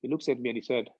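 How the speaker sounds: ordinary delivery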